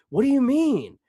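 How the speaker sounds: loud, high, hammed-up disbelief; mock astonishment. Friend questioning the conspiracy, acted blown away. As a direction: act totally blown away, pitch swooping up. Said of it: "His friend's all blown away".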